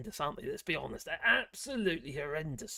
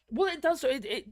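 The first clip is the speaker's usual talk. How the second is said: normal talking voice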